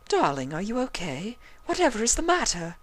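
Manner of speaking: high, fussing lady voice; concerned Rarity. A narrator's reading. Rarity noticing the stallion's face, high. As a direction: ask with airy concern.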